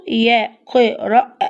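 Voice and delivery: "يقرأ" broken into slow syllables, ending on the a; teacher slowly breaking up "يقرأ"; slowly sounded out. Shown as a demonstration. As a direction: stretch "يقرأ" so the final alef is heard. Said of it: "سمعنا صوته فين؟".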